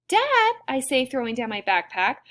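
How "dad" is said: high, loud cry of objection; exasperated protest. A narrator's reading. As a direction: cut in on dad, appalled. Daughter protests to her father.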